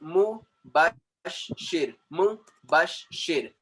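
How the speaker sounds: "Mubashir" in slow separate beats, Mu, bash, shir; split into syllables. Shown as a demonstration. Teacher showing syllables in a name, "Mubashir" split in three. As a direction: pause after each syllable of "Mubashir". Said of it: "So an is a syllable".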